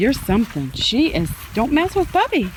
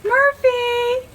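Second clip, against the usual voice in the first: high-pitched pet call; high name call; 12 semitones higher than usual. Real, not acted. Calling the dog's name.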